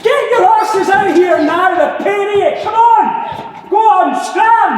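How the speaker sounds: full-force high yell; roaring to chase children away; angry shout. Acted. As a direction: bellow at them to clear off. Adult chases two children off.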